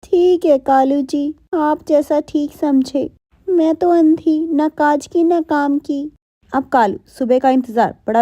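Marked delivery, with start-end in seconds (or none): 0.0-6.1 slow, higher and resigned; meek Gauri voice. Acted. Blind wife agreeing with her husband, slow and meek. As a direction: drag it out like a humble blind wife.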